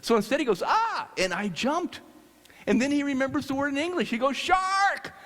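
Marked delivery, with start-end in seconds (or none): 0.6-1.1 high, short mock scream; imitated alarmed scream. Cook spotting a shark behind the diver, high mock scream. 4.4-5.1 high-pitched mock yell of alarm; acted warning cry. Cook warning the diver of a shark, high mock yell.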